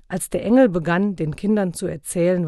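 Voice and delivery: plain reading voice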